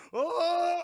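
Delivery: long, high, loud "oh" cry; acted outburst cry; drawn-out mock yell. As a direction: hold a loud hammed-up wail.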